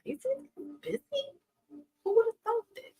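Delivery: high, far-off put-on voice; airy high mock question. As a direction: squeak it like a dainty skeptic. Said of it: "You're like".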